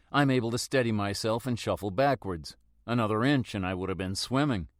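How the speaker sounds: plain reading voice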